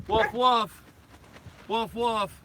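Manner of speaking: playful high woofing like a dog; mock dog bark. Owner barks at passing boat dog, playful mock woof. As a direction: bark back at the other dog for fun.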